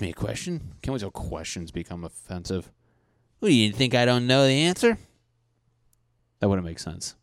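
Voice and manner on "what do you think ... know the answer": mock offended. Mocking offended question.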